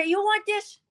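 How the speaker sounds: sharp, high mock defiance; defiant challenge. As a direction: hurl it back at him. Throwing bracelet at him.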